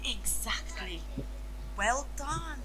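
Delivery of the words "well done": usual speaking voice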